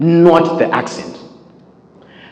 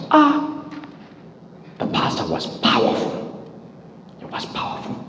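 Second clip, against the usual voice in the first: awed churchgoer; 3 semitones lower, 12 dB quieter than usual. Acted. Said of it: "ubva wanonzi".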